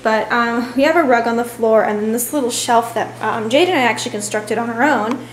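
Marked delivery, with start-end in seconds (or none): none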